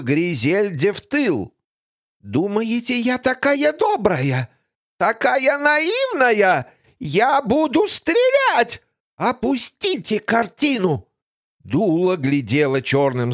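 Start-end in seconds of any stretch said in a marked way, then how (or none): 2.2-11.1 high, slow menacing threat; threatening villainess. Acted. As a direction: threaten with a gun. (угрожающая злодейка)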